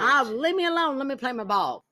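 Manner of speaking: very high mock old-timer voice; grumbling old person. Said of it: "a little old person".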